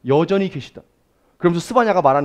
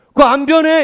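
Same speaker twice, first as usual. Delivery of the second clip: much higher and louder, firm promise; emphatic declaration; 13 semitones higher, 9 dB louder than usual. Acted. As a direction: insist it never changes. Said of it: "이런 말이에요".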